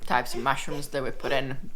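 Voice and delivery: ordinary delivery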